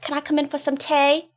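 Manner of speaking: a put-on Essex accent, high and bright; hammy attempt at Essex; an amateur's eager Essex accent. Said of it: "I have been working on an Essex accent".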